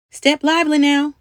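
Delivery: high, loud call through a speaking trumpet; shouted command. A narrator's reading. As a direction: hurry the crew along. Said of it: "cried the captain through his ten speaker trumpet".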